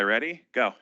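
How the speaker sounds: usual speaking voice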